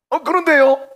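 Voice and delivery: very high, loud flustered answer; startled reply. Acted. Preacher as sleepy Cyrus answering God, high startled voice. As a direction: squeak back in alarm, hammed-up.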